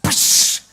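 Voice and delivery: unvoiced "pusss" burst of air; vanishing whoosh. Preacher showing a wife's aches vanishing, whooshing sound. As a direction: let out a long hissing poof.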